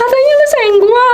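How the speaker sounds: high-pitched indignant mock scolding; scolding God. Wife scolding God like a friend, high and indignant. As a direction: confront him like an exasperated best friend. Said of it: "aku bilang gitu".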